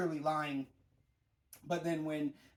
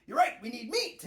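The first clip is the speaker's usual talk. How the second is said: higher, louder eager Luffy impression; imitating Luffy; 8 semitones higher, 7 dB louder than usual. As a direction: blurt it out like a hungry kid. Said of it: "And Luffy's just like".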